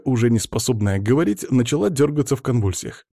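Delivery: ordinary delivery, nothing marked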